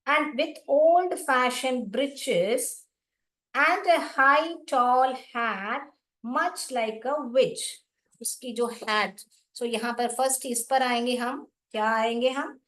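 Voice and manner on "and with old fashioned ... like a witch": reading verse aloud very slowly, word by word; slow, deliberate read-aloud; slow poem reading. Acted. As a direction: spell out each line for the class.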